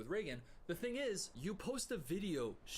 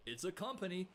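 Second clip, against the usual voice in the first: ordinary delivery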